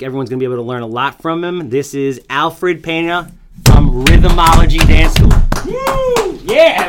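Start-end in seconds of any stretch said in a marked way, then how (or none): 1.7-5.3 loud, slow hype announcement; announcer intro. Acted. Announcing guest over music. 5.7-6.9 high, loud whoop; excited whoop. Real, not acted. Cheering guest's arrival.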